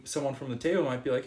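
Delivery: normal talking voice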